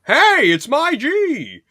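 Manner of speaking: high, loud, slow squeal; mock excited yell. Fan quoting Douglas greeting his friend, squealing. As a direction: belt it out like the sitcom character.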